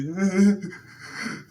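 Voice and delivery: loud, high mock wail; hammed-up burst of crying; imitated sobbing. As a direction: mimic a child's upset sobbing. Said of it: "She was so upset".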